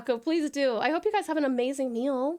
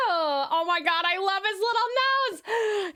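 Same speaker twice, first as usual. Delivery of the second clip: cooing; 6 semitones higher, slower than usual. Real, not acted. Cooing over fan art.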